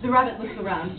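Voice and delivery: ordinary talk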